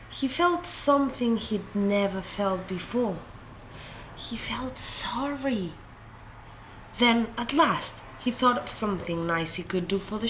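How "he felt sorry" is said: hushed whisper, slow. A narrator's reading. Narrator revealing King Pig's regret, soft slow whisper. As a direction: breathe it like a confession. Quiet moment of remorse.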